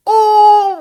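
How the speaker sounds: high, drawn-out falsetto "oh"; loud, squealed reaction, held long; gleeful ooh. Retold or imitated. Retells his gleeful reaction to the remark.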